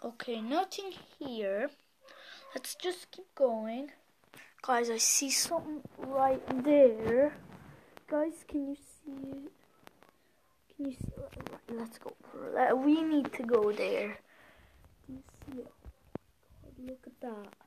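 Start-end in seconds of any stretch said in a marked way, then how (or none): 2.5-3.9 hushed sneaking whisper. Real, not acted. Sneaking around hunting a clown. 8.1-17.5 tense hushed whisper, spooked; scared horror game streamer. Real, not acted. Spotting the clown, hushed and tense.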